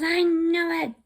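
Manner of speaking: high, loud pouty reply; sulky high-pitched agreement; mock whiny daughter. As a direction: play the cranky pregnant daughter. Daughter agreeing, voiced in story.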